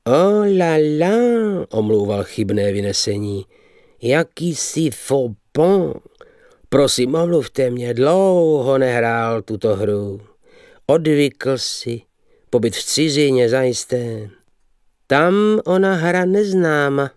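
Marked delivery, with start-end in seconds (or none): 0.1-1.7 high, slow foppish "Oh la la"; affected dandy. Acted. The dandy excusing his misplay, with a slow, high affected sigh. 4.0-17.1 slow, lightly foreign fop voice; affected dandy. Acted. The young man excusing his errors to the table, in a slow, foppish foreign-tinged voice.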